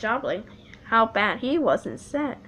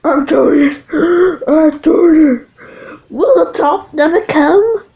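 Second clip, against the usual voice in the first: straining effort; 7 semitones higher, 14 dB louder than usual. Acted. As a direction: push through the climb. James straining uphill.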